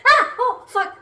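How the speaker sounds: loud high shriek, then swearing with a laugh; real fright, half laughing; startled yelp. Real, not acted. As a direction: jump at the fly and yell. Startled by a fly.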